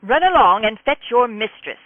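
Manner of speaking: high, loud, lordly British fop command; haughty fop. Acted. Rafe commands the butler to fetch Silver, high and haughty. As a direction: order the servant about like a pompous lord.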